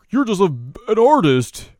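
high, loud mocking jeer; hammed-up bully taunt. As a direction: sneer it down at him. Guards mocking the artist.

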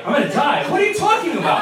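rising high into incredulous questioning; mock alarm. Speaker on the phone told he is gonna die, mock-alarmed disbelief. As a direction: throw it back in exaggerated disbelief.

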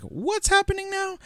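high baffled squeak; high confused squeak. Retold or imitated. Confused reaction quote.

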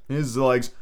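ordinary delivery

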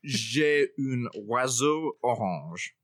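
slow, careful French phrase; slow classroom French; schoolbook French. Pretend. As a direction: spell it out like a language learner. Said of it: "Welcome to French at the Table".

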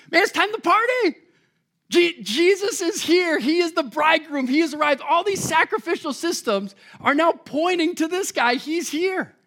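excited party call. Pretend. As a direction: celebrate like the groom just walked in. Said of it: "Here is my attempt".

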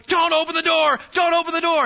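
high, loud acted yell at the screen; moviegoer's warning shout. Audience in the theater as person walks into danger, shouted warning. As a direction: shout a frantic warning to a film character. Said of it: "you're in the theater going".

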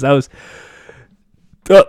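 ordinary talk

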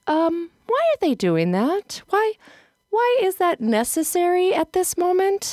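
high, anxious mock puzzlement; puzzled worry. Mom watching others check in, puzzled.

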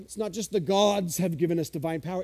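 normal talking voice

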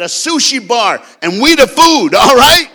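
loud, high comic panic; hammed-up yelling in alarm; alarmed shout. Retells alarmed warning in open jeep.

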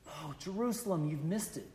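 softly retold weeping, voice sinking; sorrowful lament. Preacher voicing Jesus weeping over Jerusalem, soft and sorrowful. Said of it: "Same kind of tone right here".

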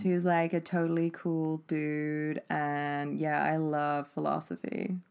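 slow, laid-back Californian dude; lightly done Californian accent; mock Californian. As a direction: drawl it like a chill Californian surfer. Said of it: "Sorry, Californian".

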